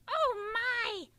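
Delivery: high, loud, slow delighted puppet; enthusiastic puppet voice. Acted. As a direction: gush over food like an eager puppet. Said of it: "Mud Pie is very enthusiastic".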